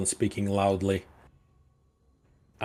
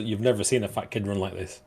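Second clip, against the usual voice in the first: usual speaking voice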